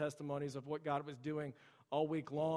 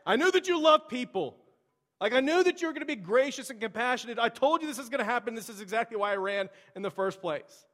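much higher, louder whine falling off at the end; whining complaint; 7 semitones higher, 7 dB louder than usual. Acted. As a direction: sulk at God like a toddler. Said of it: "he's complaining and he's whining to God".

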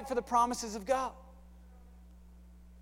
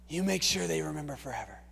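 soft and lower, fading to a whisper; intense coach whisper; 8 semitones lower, 9 dB quieter than usual. Retold or imitated. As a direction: lean in and breathe the last words. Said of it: "he says that phrase".

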